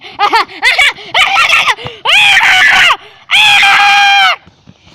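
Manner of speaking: loud, shrieking villain laugh; evil cackle. Pretend. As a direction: cackle rising into a high scream. Villain gloats that plan works.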